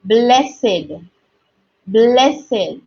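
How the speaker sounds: blessed said bless-id; blessed as two syllables. Shown as a demonstration. Teacher modelling blessed for pupils, bless-id. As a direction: say Blessed with an id ending. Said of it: "Not blessed".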